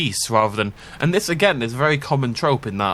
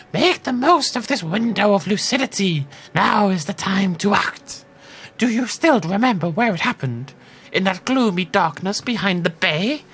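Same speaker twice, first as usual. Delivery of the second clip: put-on old woman voice; 8 semitones higher than usual. Player voicing the lighthouse keeper in a spooky old woman voice. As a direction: croak it out like a spooky old lady.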